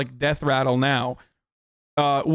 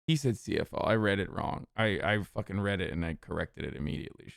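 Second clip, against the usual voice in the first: low, much softer self-correction; muttered aside; 9 semitones lower, 10 dB quieter than usual. Real, not acted. Correcting his own misread, low and much softer. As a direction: grumble it under your breath.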